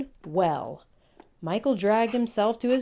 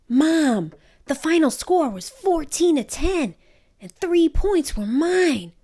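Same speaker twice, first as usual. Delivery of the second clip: high, proud report to mom; excited boy; 9 semitones higher, 4 dB louder than usual. Acted. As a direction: burst in with the good news.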